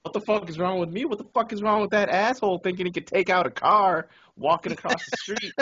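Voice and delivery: high, incredulous rant; retold indignation. Driver defending himself to his Canadian passenger, indignant. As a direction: protest in mock outrage, pitch staying up.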